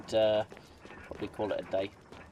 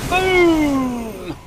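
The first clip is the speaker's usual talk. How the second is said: much higher, loud "Boom!"; triumphant shout; 15 dB louder than usual. Real, not acted. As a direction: yell it as the shot lands. Gamer blasts zombie with gun.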